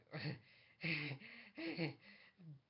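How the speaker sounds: high, drawn-out uneasy mock laughter; agitated laugh. She doubts Steven's news, agitated acted laughter. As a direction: laugh on in bursts, getting agitated. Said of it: "getting a little agitated".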